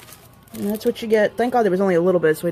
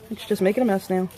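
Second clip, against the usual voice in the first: ordinary talk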